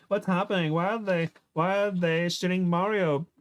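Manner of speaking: high-pitched, bewildered questioning; acted alarm. Heroine asks Kim about the shooting, high alarmed questioning. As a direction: plead to know what is going on. Heroine panics under gunfire.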